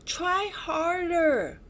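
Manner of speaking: high, slow, loud shout. A narrator's reading. Stu calling from inside the blocked cave, loud urging shout. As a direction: call through rocks to urge him on. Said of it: "shouted Stu".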